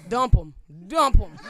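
loud, blunt command; hammed-up loud granddad command; mock order. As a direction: bark it like a stern granddad.

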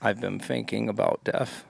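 depressed Elmer Fudd. Acted. Hunter confiding dark thoughts to the rabbit, depressed. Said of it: "really sad Elmer Fudd, like, a depressed one".

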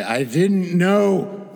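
drawn-out, high, loud protest; loud, hammed-up plea of ignorance; mock protest. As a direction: wail it in mock innocence, stretching the words. Acting out his character while dodging.

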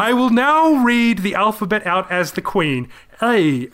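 squeaky failed Queen voice, high; a botched posh royal voice. Pretend. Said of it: "I tried to do my Queen impression".

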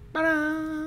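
high showy ta-da; ta-da reveal. Real, not acted. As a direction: present it like a magic trick. Presenting the found word.

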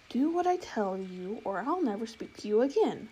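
ordinary delivery

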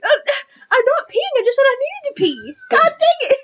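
high, flustered protest; squealing in frustration; exasperated. Real, not acted. Teased about needing to pee.

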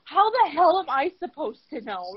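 exasperated, loud and high; exasperated retort. Retold or imitated. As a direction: snap back at dad in frustration. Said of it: "I'm like".

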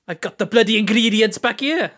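loud, high mock Welsh; Welsh accent. Friend mimicking the other's accent phrase, loud mock Welsh. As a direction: imitate a friend's Welsh catchphrase, loudly. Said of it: "That's his phrase".

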